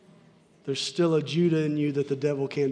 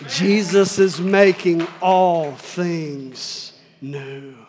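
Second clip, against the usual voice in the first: very slow, every word leaned on, louder; slow emphatic repeat; 6 dB louder, much slower than usual. Shown as a demonstration. Preacher repeating the promise to the congregation, slow and emphatic. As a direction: drag out Jesus is making all things new for weight.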